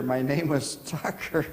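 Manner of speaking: normal talking voice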